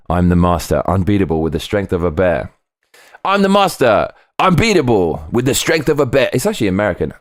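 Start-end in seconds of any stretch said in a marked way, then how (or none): 3.2-6.3 nineties cartoon hero. Shown as a demonstration. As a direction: boom it out like a nineties cartoon hero's catchphrase. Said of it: "It sounds like a nineties cartoon".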